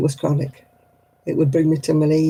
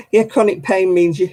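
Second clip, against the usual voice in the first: ordinary talk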